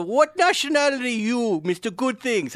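high, sneering mock Middle Eastern scammer; angry Middle Eastern accent. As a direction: taunt them in a put-on Middle Eastern accent. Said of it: "angry Middle Eastern guys".

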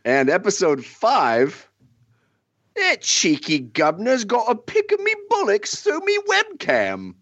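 a light mock Cockney that rises on the punchline; cheeky put-on Cockney; Cockney accent. [name] reads the final parody episode in a light mock Cockney. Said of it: "with your best accent".

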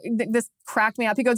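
ordinary talk

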